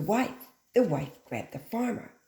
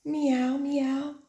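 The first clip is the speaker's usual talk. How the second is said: high meowing; cat meow; 8 semitones higher, 3 dB louder than usual. Acted. The cat answering the dog, high meowing.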